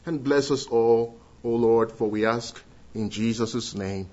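usual speaking voice

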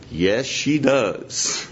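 slow Southern drawl, a fond yes; slow Southern drawl with a twinkle. Retold or imitated. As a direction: stretch it out like a Southern pastor. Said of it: "southern drawl".

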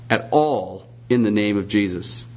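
usual speaking voice

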